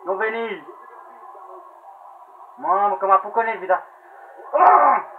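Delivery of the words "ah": short, high, loud scream; frustrated scream. Real, not acted. Gamer under attack in the game, frustrated scream. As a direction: yell out at a lost move.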